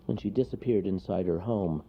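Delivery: ordinary delivery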